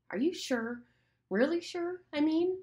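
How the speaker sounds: high, anxious pleading; anxious Piggy. Acted. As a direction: beg for reassurance, voice up high. Piggy anxiously questions Ralph.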